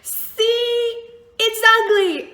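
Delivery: high, loud triumphant see; loud, high vindicated outburst; triumphant told-you-so. Retold or imitated. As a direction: crow it, vindicated. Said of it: "And I was like".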